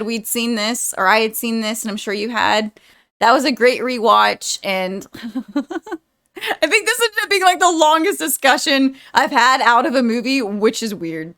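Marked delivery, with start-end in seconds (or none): 4.6-8.2 laughing through tears. Real, not acted. Laughing while emotional.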